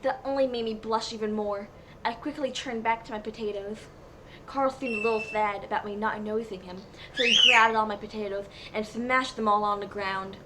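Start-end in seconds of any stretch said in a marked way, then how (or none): none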